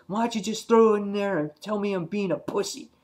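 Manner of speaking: acted-out indignation in a high, strained pitch; pissed off. Alan challenging Andrea over her advice, pissed off. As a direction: throw the question back angrily, voice way up. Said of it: "all pissed off".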